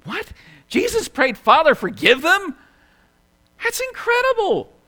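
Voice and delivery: high, loud disbelief; incredulous amazement. Acted. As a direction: burst out as if you can't believe those words. Preacher acts amazement at the prayer.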